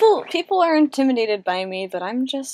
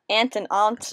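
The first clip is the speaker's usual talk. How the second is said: ordinary talk, nothing marked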